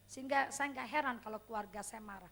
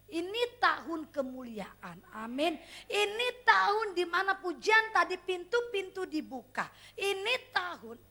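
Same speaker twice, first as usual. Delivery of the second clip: loud proclamation; 3 semitones higher, 8 dB louder than usual. Real, not acted. Proclaiming year of glory.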